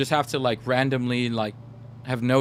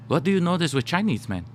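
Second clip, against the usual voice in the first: higher put-on Japanese accent, questioning; 4 semitones higher than usual. Coach quizzing the interviewer on the player, higher Japanese accent. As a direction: ask it in an imitated Japanese accent, pitched up. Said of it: "I'll do my Japanese accent".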